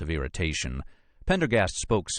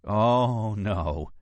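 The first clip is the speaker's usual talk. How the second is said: dismayed groan, higher at the start; 4 semitones higher than usual. A narrator's reading. Archaeologist sees whose office it is, groaning in dismay. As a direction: groan it out in dread. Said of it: "groaned Nora".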